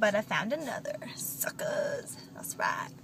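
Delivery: hushed, sly whisper. Real, not acted. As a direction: whisper it like a secret. Sneaky aside about stolen spot.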